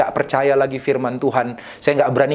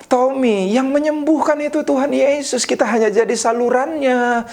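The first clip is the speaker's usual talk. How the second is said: put-on high, sing-out Ambonese accent; Pak Yeye's Ambonese accent; 10 semitones higher than usual. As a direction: imitate an older colleague's Ambonese accent, pitched well up. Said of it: "Pak Yeye berkata dengan logat Ambon".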